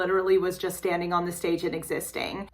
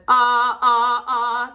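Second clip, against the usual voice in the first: louder, pressurized chest-register calls; 5 semitones higher, 7 dB louder, much slower than usual. Shown as a demonstration. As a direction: demonstrate chest voice with firm, calling sounds. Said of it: "your chest voice is more of this".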